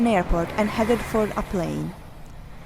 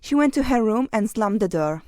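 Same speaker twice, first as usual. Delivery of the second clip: usual speaking voice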